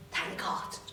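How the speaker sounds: hushed, private whisper of thanks; relieved whisper. Acted. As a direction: whisper it under your breath. Relief once everyone had gone.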